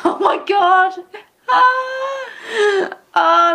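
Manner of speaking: high squealing laugh over the ship name. Real, not acted.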